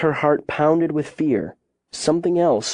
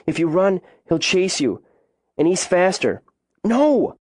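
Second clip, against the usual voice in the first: put-on higher, anxious child's warning, jumping up on "No!"; urgent warning; 6 semitones higher than usual. A narrator's reading. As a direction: warn him urgently, voice climbing. Girl warns of cougar chasing.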